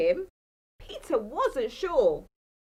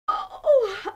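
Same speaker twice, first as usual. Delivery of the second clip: plain reading voice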